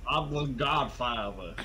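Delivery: slow, put-on mob boss voice; the Godfather. Serious movie awkwardly saying its title, Godfather impression. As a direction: stretch the title out grandly. Playing Godfather naming himself.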